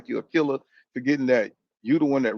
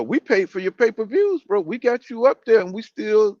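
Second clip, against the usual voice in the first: fan's complaint; 6 semitones higher than usual. Retold or imitated. Fans telling the fighter they paid for his fights, indignant and higher. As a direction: play a fed-up boxing fan demanding his due.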